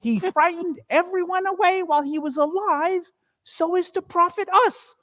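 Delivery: high, cackling crone gloats over the loot; gloating old charwoman. Acted. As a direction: relish every stolen thing.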